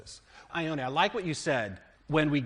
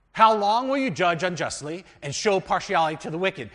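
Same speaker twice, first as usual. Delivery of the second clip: raised, higher voice proclaiming the verse; scripture reading; 5 semitones higher, 10 dB louder than usual. Acted. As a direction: read it out like a preacher at the pulpit. Reads scripture aloud.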